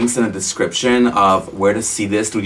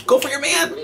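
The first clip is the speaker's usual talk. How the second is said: cheering. Real, not acted. Cheering a character on.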